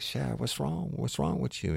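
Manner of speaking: high, concerned voice for the guy at the bar; concerned Cajun man asking what's wrong; mock concerned man. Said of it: "He's like".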